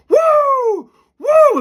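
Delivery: very high, loud "woo"; wild Ric Flair-style whooping; aggressive whoop. Retold or imitated. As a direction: whoop like an out-of-control ten-year-old. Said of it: "aggressively like I'm Ric Flair".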